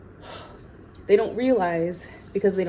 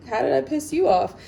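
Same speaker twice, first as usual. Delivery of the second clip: indignant; 7 semitones higher, 4 dB louder, faster than usual. Real, not acted. Upset by an agent's comment.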